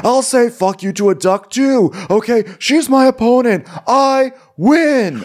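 high, petulant mock bragging; regressed five-year-old. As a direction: stamp it out like a child who must win. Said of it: "like, being five years old".

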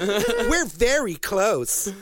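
high, slow pretend Vocaloid twin; mock creepy child. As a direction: draw it out in a high, suggestive doll voice. Voices the childlike Vocaloid twins.

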